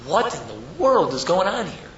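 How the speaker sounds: slow, high-swooping bewilderment; dumbfounded. Acted. Rav who cannot understand what he sees, dumbfounded. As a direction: drag it out in baffled disbelief. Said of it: "just sitting there dumbfounded".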